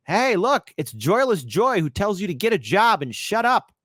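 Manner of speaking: ordinary talk, nothing marked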